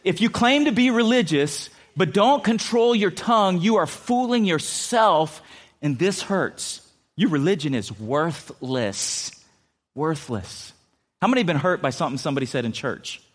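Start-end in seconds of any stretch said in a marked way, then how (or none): 0.0-6.8 preaching scripture. Acted. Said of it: "James says it like this". 7.2-10.7 slow, falling "Worthless." pressed home; stress on worthless. Real, not acted. Preacher drives home the verse, slow and heavy. Sermon emphasis, repeated.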